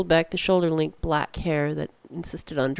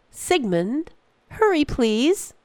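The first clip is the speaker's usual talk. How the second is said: high, slow, louder impatient call; snapped; 10 semitones higher, 3 dB louder, much slower than usual. Acted. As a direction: snap at him to hurry, voice up high. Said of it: "Einar snapped".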